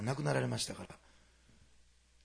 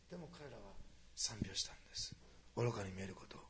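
whisper; 5 semitones lower, 11 dB quieter than usual. Acted.

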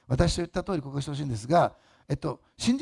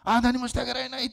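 mock despair; 10 semitones higher, 3 dB louder than usual. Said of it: "と落ち込まなくていいんです".

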